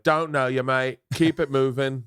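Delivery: a hint of Aussie bouncer brushing you off; lightly voiced Aussie doorman; mild Australian accent, dismissive; accidental Australian. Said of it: "That's Australian".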